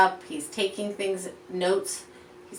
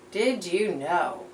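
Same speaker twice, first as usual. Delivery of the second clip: normal talking voice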